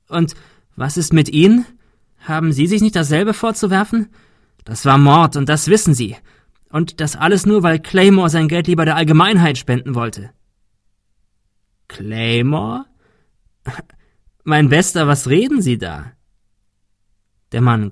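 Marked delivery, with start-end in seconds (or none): 0.1-10.3 high, loud, indignant challenge; raised, accusing voice; heated accusation. A narrator's reading. Ben accuses the gunman. 11.9-16.1 amused, condescending villain; hoarse-laughing, patronising disbelief; mocking villain. A narrator's reading. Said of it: "Der Mann im Nebel lachte heiser".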